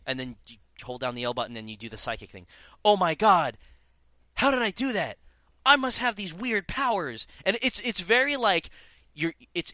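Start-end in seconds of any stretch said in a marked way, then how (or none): 2.8-7.3 high, hammed-up amazement; mock a badly acted discovery of powers; contrived amazement. Game hero discovering psychic powers, contrived amazement. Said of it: "it's very contrived".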